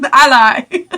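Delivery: laughing it out loud. Real, not acted. Correcting herself about the shirt, laughing. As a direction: crack up mid-phrase.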